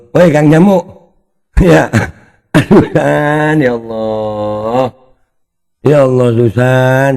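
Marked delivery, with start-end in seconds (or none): 2.5-7.1 slow, drawn-out mock wail; hammed-up slow exasperation; mock lament. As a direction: stretch it out like a put-on grieving sigh.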